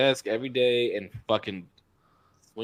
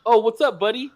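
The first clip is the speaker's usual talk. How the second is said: raised, louder "what's up, buddy"; imitated casual greeting; 8 semitones higher, 4 dB louder than usual. Correcting how he greeted me, imitated upbeat hello. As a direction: imitate his greeting, lifted and rising at the end. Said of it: "He was like, he was like".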